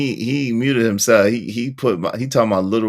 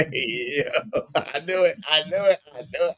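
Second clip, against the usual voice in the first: softer, higher words squeezed through laughter; laughing; 4 semitones higher, 7 dB quieter than usual. Real, not acted. Host cracking up at the guest's love line, laughing helplessly. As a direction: crack up as you repeat yourself.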